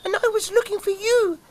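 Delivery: high, fast chick voice; tiny squeaky chick; cheeping chick. Acted. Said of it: "cheeped little chick".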